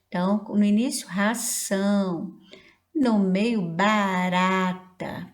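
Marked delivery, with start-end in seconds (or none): 1.1-2.4 ração drawn out syllable by syllable; slow model word. Shown as a demonstration. Teacher giving a word starting with rá, ração slowly. 3.6-5.3 barata with long, stretched syllables; slow model word. Shown as a demonstration. Teacher giving rá in the middle, barata slowly stretched.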